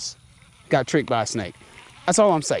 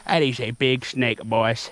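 ordinary talk, nothing marked

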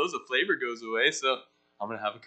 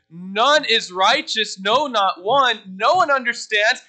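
slow, loud, weighty verse reading; emphatic scripture reading; 6 semitones higher, 6 dB louder, much slower than usual. Acted. Preacher reading Romans aloud to the group, slow and forceful. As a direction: proclaim each phrase of the verse.

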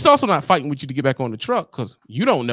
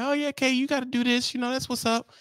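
high, soft mock wife voice; eager wife; 10 semitones higher, 4 dB quieter than usual. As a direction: play the wife sweetly shooing him off. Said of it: "And she like".

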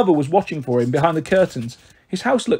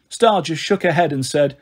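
usual speaking voice

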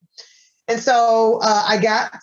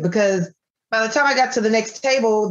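ordinary delivery

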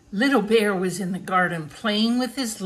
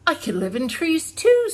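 much higher, louder eager cub line; 9 semitones higher, 4 dB louder than usual. Acted. Little Bear telling Grandfather he could live in trees, eager. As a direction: pipe it up eagerly.

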